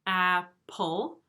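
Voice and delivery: apple said in two beats with the chin; apple split ap-ple; syllable demo. Shown as a demonstration. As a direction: mark each beat of apple. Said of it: "I like using my chin and going like this".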